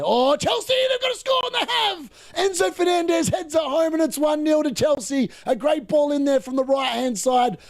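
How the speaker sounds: high shouted commentary burst as the goal goes in; excited goal call. Real, not acted. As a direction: yell it like a live goal call, pitch soaring. Goal scored live during match.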